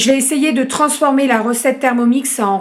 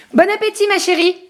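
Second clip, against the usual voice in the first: high-pitched, affectionate sing-out; talking to a little one; 10 semitones higher than usual. Real, not acted. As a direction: wish it sweetly in a high voice. Wishing the child a good meal.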